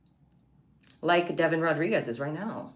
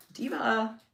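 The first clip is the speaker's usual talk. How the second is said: high, sassy "Diva!" call; diva exclamation; 8 semitones higher than usual. Pretend. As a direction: belt out "Diva!" like a diva. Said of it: "Diva time".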